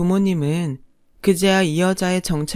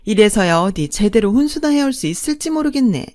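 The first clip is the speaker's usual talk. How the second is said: higher, louder swooping sneer; haughty second mother-in-law, scornful; 4 semitones higher, 4 dB louder than usual. Acted. Second mother belittling the bride's parents, haughty and scornful. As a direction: look down on them with sing-song disdain.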